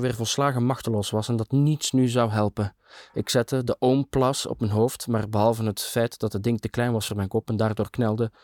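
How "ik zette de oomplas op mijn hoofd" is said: usual speaking voice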